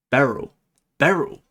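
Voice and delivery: ordinary delivery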